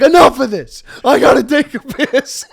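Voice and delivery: loud, angry outburst; fed-up shout. Retold or imitated. Friend quoting his buddy's fed-up shout, loud. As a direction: bark it out, sick of walking. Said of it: "he's like".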